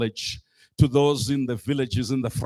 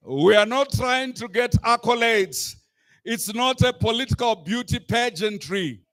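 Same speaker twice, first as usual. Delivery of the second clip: rousing shout; 6 semitones higher, 3 dB louder than usual. Real, not acted. Climax of a rally speech.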